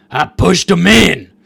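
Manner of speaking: loud, slow deranged confession; mock crazed patient. Patient confessing to the nurse, loud crazed voice. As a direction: bellow it slowly like a crazed patient.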